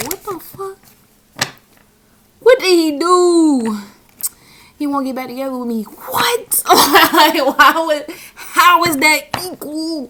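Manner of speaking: high, loud laughing outrage; retold shock at a girl's curse request, loud and high with laughter; shocked disbelief.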